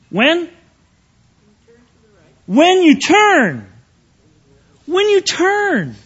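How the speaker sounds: "When you turn" high and drawn out, pausing between; emphatic stress. Real, not acted. Preacher drives home the verse's timing to listeners, "When you turn" slow and emphatic. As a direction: punch "When" and stretch "turn" slowly, pitched high. Preacher hammering the key word.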